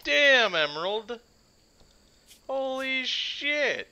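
thrilled shouting at a one-shot kill in the game; excited shouting. Real, not acted. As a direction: yell in disbelief at the kill.

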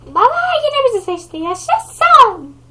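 put-on high, happy child cheer; a little boy thrilled to be chosen; excited kid. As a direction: kid cheering to his dad. Kid voice cheers being picked.

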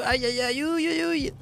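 rising high whiny aïe aïe; mock pained whimpers. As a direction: squeal in exaggerated mock pain. Mock pain cries.

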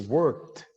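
"worked" with k and d fused into kt; joined ending. Shown as a demonstration. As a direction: say "worked" as one syllable, wərkt. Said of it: "work와 the가 같이".

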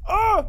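high-pitched cry of fright; real scared yelp; startled yelp. Real, not acted. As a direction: yelp in sudden shock. Startled by horror game.

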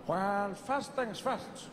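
slow, deliberate elder; Nelson Mandela. Acted. Mandela tells Obama to change his voice, slow statesman. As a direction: counsel him with long drawn words.

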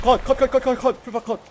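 excited shouting. Real, not acted. Calling kills in firefight.

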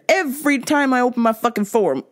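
ordinary talk

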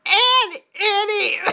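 acted coughing fit. A narrator's reading. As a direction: drag the words out, then cough. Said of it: "he coughed".

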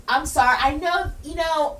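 ordinary talk, nothing marked

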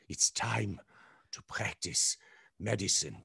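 a hint of the mad doctor; Medic voice. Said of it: "that line that I said".

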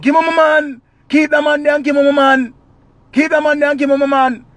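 high, loud wailing woman; high loud plea for her man; Daima's wail. Acted. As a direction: bawl it out desperate for her man.

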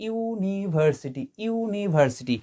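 ইউনিভার্সিটি said slowly and softly, starting with a clear ইউ sound; pronunciation demo. Shown as a demonstration. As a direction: draw out the ইউ at the start of ইউনিভার্সিটি for the class. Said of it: "তার উচ্চারণটা আমরা কার মতো করছি?". (ইউনিভার্সিটি ধীরে, নরম গলায়, শুরুর ইউ স্পষ্ট করে)

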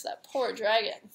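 plain reading voice

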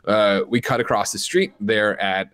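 ordinary talk

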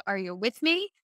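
"Are you with me?" with "me" swept upward; questioning lift on "me"; repeated presenter catchphrase. Retold or imitated. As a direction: imitate a presenter checking in, rising on "me". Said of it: "I remember that he said".

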